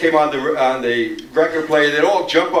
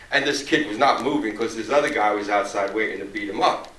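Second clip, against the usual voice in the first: ordinary delivery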